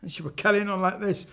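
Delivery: usual speaking voice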